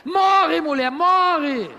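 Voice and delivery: high, loud and slow payback cry; mock shout back. Rich Job to his wife, a slow mock shout. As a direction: drag out the revenge line.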